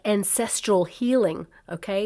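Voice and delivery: normal talking voice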